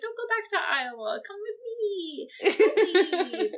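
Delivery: high, laughing plea; mock pleading whine. Begging Chris not to go back to Iowa, high whine. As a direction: whine at him not to go, giggling. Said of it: "how many times I said to Chris today".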